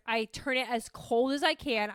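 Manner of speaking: ordinary talk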